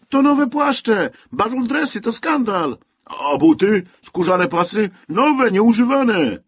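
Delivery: loud, high, indignant crowd voice; outraged shout. A narrator's reading. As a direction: protest at the burning pile. Crowd protests burning new clothes. (oburzony krzyk, głośno i wysoko)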